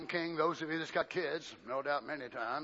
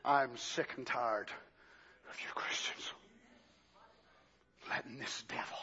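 intense hush; 4 semitones lower, 4 dB quieter than usual. Acted. Preacher drops to intense hush.

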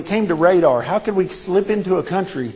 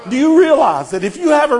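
loud, higher, triumphant declaring; full-force preaching; 6 semitones higher, 4 dB louder than usual. Acted. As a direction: proclaim it to the congregation.

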